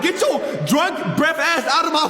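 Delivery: high, half-laughing mock outburst; exasperated rant. Storyteller telling off the drunk girl in his face, mock-angry high rant.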